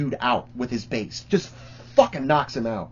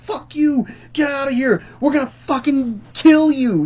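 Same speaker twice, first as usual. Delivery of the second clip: mock crowd threats; 10 semitones higher than usual.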